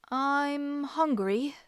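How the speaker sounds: slow, drawn-out small voice; said slowly. A narrator's reading. Hungry boy answers the stranger slowly and carefully. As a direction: hold back each word, unsure. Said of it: "Josh said slowly".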